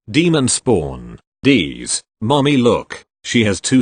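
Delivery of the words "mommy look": plain reading voice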